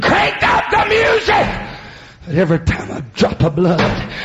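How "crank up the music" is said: the devil howls it to all of hell; devil screaming. Retold or imitated. The devil orders hell's party, screaming high. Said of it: "the devil screamed".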